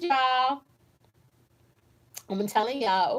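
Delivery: ordinary talk, nothing marked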